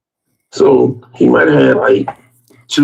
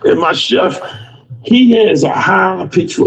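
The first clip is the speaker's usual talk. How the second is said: ordinary delivery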